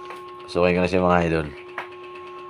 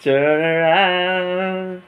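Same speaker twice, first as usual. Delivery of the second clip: higher, slow sing-song call; drawn-out teasing call; 10 semitones higher, slower than usual. Acted. Husband coaxing his wife to turn around, slow high teasing call. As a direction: coax her round, slowly and playfully.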